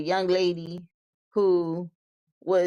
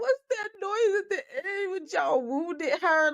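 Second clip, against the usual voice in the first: high, laughing disbelief; 9 semitones higher than usual. Real, not acted. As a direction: crack up while asking it. Laughing disbelief at arrest footage.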